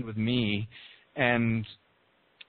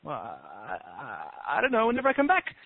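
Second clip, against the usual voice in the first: noncommittal bird, dithering then pitched up; evasive little bird. Acted. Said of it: "And the little bird says".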